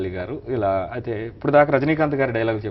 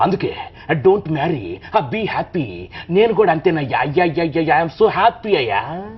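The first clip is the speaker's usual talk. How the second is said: louder, higher flamboyant Rajini delivery with wails of అయ్యా; Rajinikanth impression; 4 semitones higher, 5 dB louder than usual. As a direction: play Rajini lamenting with comic flair. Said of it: "Rajinikanth గారు చెప్తున్నా ఎలా ఉంటుంది?". (రజనీకాంత్ గొంతులో హుషారుగా)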